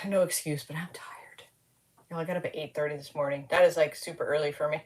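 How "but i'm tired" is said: tired whisper. Real, not acted.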